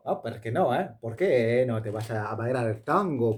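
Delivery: teasing the listener in a put-on Spanish accent. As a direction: ham up a Spanish tango dancer's accent. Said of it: "j'ai fait cet accent".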